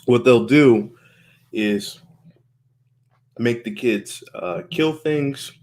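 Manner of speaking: normal talking voice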